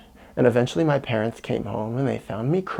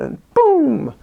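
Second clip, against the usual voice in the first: high loud boom; sudden imitated bang; boom sound effect; 13 semitones higher, 4 dB louder than usual. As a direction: burst out the gunshot noise. Shooting the BB gun.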